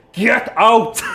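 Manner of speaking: barked order, high and loud; drill sergeant shout. Acted. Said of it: "I'm gonna be drill sergeant here".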